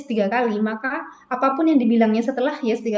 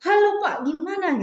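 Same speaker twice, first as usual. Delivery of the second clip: high, eager "Halo Pak" on the phone; lively phone greeting; 9 semitones higher than usual. Shown as a demonstration. Teacher demonstrating a matched, lively phone greeting. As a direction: pitch the "Halo" way up. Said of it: "Misalnya ya".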